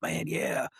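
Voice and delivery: hushed whisper so the gobbler won't hear. Retold or imitated. Hunting partner whispering in the pine stand, hushed whisper. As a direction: breathe it out with no voice at all.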